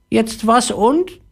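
very high slow parrot question. Acted. Disappointed parrot asking the boy slowly. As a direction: ask it slowly in a squeaky parrot voice. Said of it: "fragte der Papagei".

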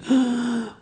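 sharp high gasp of amazement; awed gasp. Retold or imitated. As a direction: gasp in wonder like a child. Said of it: "She went".

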